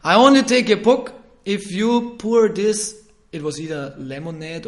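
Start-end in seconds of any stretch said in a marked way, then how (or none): 0.0-2.9 louder, slow, higher teasing dare; hammed-up cheeky dare; mock challenge. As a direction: dare him slowly and loudly. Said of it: "one person said".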